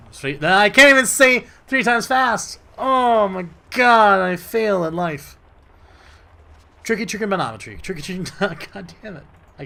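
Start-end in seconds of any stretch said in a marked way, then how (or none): 0.1-5.4 loud, high frustrated wail; exasperated outburst. Real, not acted. Gamer failing a tongue twister, loud exasperated wail. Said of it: "I'm so flabbergasted my lips don't wanna work". 6.8-8.7 "Tricky trigonometry" repeated higher; tongue twister. Shown as a demonstration. Gamer retrying the tongue twister, rushed. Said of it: "Say that three times fast".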